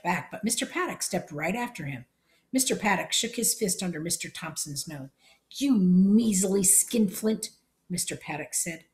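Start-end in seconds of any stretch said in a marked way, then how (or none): 5.5-7.5 slow, drawn-out scorn; contemptuous insult. Acted. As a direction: spit the insult out slowly at the miser. Paddock scolds Thompson.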